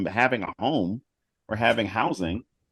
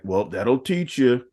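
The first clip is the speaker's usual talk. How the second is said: smug boomer voice; slower than usual. Retold or imitated.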